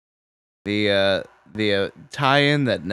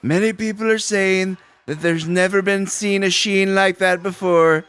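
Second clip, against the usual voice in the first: higher bragging politician voice; boastful politician; 9 semitones higher, 3 dB louder than usual. Pretend. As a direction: puff it up like a braggart. Mock Trump-style boast.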